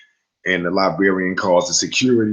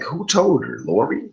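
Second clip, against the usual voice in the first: a much higher, disbelieving question; incredulous; 8 semitones higher than usual. Retold or imitated. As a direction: shoot it up in shock, half mock. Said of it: "So I'm like".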